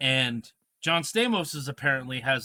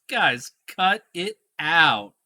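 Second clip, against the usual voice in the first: slow, louder scolding catchphrase; Uncle Joey catchphrase; 3 semitones higher, 7 dB louder, much slower than usual. Retold or imitated. David Coulier telling the pranksters to cut it out, slow mock scold. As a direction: drawl it like the sitcom uncle. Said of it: "looks at us and says".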